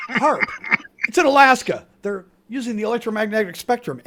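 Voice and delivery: insistent loud explaining. Acted. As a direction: push the theory hard. Conspiracist insists loudly.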